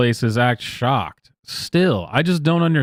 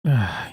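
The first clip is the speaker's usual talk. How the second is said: weary exasperated sigh; disgusted sigh; 4 semitones lower, 4 dB quieter than usual. Real, not acted. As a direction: let out a tired sigh of disbelief.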